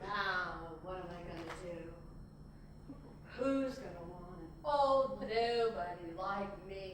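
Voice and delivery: low, slow, self-pitying monologue; forlorn stage lament. Acted. Anna's character left alone, theatrically forlorn. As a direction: sink down low and mourn with long pauses.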